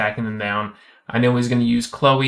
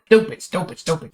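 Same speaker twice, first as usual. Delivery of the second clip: higher, rapid-fire self-scolding; frustrated outburst; 6 semitones higher than usual. Real, not acted. Player kicking himself over not getting more Ragdoll, frustrated. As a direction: blurt it out in frustration, pitched up.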